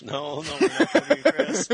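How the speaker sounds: hammed-up insistence that we aren't them; mock exasperated denial. Retelling correcting a fan, hammed-up high loud protest. Retold denial, big jump.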